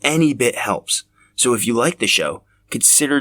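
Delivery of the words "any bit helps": usual speaking voice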